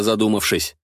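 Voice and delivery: ordinary delivery, nothing marked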